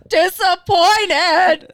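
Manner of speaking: drawn-out high dramatic Disappointed; Hercules quote voice. Pretend. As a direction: stretch Disappointed high and slow, like the TV hero. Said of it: "It's from Hercules".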